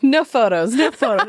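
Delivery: laughing, hammed-up no photos; shooing paparazzi; mock celebrity. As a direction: play a diva dodging cameras. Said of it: "I was like".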